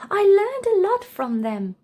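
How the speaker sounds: put-on a happy boy telling grandpa; lightly voiced cheerful boy; grateful child.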